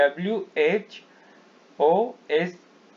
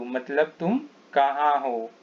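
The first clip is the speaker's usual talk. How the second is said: ordinary talk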